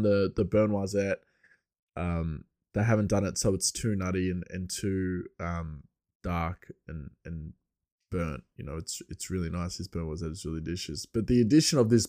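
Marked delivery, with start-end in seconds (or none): none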